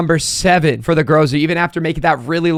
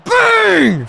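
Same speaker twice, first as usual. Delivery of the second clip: excited shout; much slower than usual. Real, not acted. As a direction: yell in triumph. Kick goes through.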